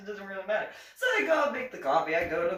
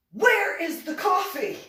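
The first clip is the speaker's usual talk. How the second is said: higher and louder shout, an irritated boss; angry demand; 9 semitones higher, 8 dB louder than usual. Retold or imitated. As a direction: bark the question like a furious captain. Angry captain demanding coffee.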